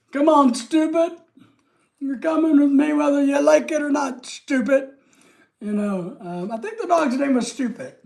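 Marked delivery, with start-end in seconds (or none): 0.1-4.9 raised-pitch mock command to a dog; lightly acted movie line, pitched up; calling a dog.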